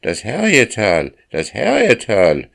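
high, loud rooster's cry; crowing rooster. A narrator's reading. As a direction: crow the name out like a cock. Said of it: "krähte der Hahn".

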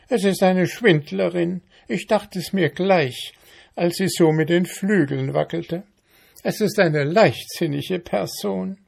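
high, indignant ant voice. A narrator's reading. Ant denouncing mayfly to beetle, indignant.

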